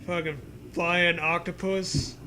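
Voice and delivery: ordinary talk, nothing marked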